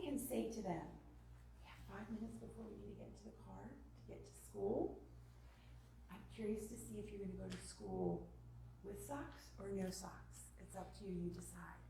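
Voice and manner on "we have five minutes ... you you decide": soft, unhurried hush; calm choice-giving. Shown as a demonstration. Mom calmly giving child sock choice before school, soft. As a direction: offer the choice gently, trailing nearly into whisper. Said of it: "when that right brain is calm".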